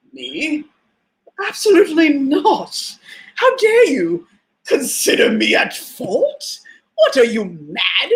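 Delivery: haughty mock indignation, a bit slow; the ego refusing any blame, dripping offence; perfection voice. Her ego denying fault when wrong, haughty mock. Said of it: "my perfection voice".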